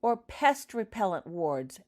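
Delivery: a nasty little jab; unkind jibe. Acted. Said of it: "laughing unkindly".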